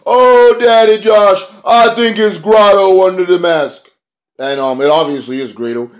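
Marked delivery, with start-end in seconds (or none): 0.1-3.9 much higher, louder, slower hyped-up announcer voice; mock commentator reveal, high and loud; excited mock Pope. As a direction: shout it like a stunned commentator, hammed up. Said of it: "the Pope".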